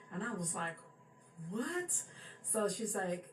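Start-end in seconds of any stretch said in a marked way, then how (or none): 1.5-2.0 high rising what, caught off guard; stunned what. Retold or imitated. Hearing grandmother saw everything, a stunned high what. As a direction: jump up in disbelief.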